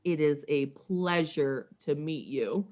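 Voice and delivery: slow, measured distinctive star voice; Chris Carter. Retold or imitated. Football star greeting a tearful fan, slow and measured. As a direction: imitate him slowly and deliberately. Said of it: "he has that distinctive voice".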